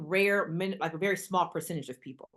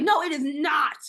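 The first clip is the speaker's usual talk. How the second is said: high, loud mock protest; disbelieving pushback; playful indignant denial; 8 semitones higher, 6 dB louder than usual. Protesting her friend's claim.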